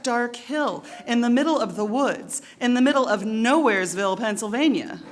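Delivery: hammed-up tale of being led astray; mock exasperation. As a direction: build to a loud peak on the final place name. Comic exasperated tale of GPS misdirection.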